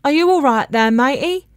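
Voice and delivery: friendly workman's call across the garden. Acted. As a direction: call it out, easy and friendly, rising at the end. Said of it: "Someone sang out".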